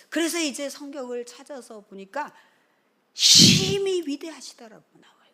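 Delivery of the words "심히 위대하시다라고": high, loud proclamation of scripture; loud proclaiming. Real, not acted. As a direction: proclaim "심히" with full force. Preacher stresses scripture. (높고 크게 선포하듯)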